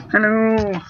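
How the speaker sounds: attempted Oak impression that turned into a puppet voice; Kermit. Shown as a demonstration. Demonstrating the failed Professor Oak impression to a friend, Kermit-like. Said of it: "the Professor Oak voice, where he sounds like Kermit".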